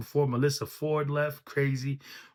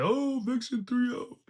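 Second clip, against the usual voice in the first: mocking the crowd; 10 semitones higher than usual. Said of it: "I'm seeing everybody say".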